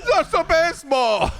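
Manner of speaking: high, loud announcer yell; video game announcer. Retold or imitated. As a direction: belt the title like a game announcer. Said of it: "the video game announcer".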